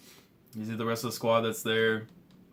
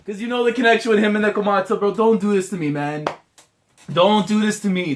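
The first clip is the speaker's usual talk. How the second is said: raised, loud begging; emotional pleading; 7 semitones higher, 5 dB louder than usual. Real, not acted. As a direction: plead loudly, higher than usual. Pleads over the characters' bond.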